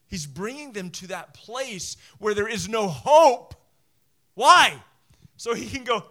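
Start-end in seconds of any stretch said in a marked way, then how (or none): none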